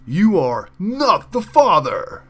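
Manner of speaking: loud, slow, big reveal; talk show announcer. Acted. TV host announces the paternity result, loud and slow. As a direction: boom it out like a daytime TV host.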